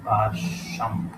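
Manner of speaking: slow, stretched باشم; drawn out. Shown as a demonstration. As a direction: say باشم slowly in two long syllables. Sounding out the answer word.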